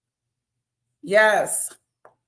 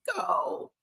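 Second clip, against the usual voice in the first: put-on high, soft whiny little girl; whiny child; 7 dB quieter, much faster than usual. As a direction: whine like a kid who won't go to school. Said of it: "She's not like".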